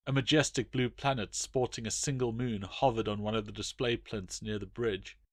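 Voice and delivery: ordinary delivery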